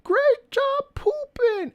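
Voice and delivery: very high, slow praising coo; meme quote. Retold or imitated. Quoting a girl praising her pooping dog. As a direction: praise it like a doting girl to a puppy. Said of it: "You ever seen that meme?".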